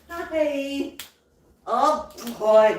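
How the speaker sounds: usual speaking voice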